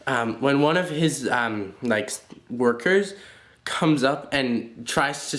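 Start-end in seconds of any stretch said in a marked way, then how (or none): none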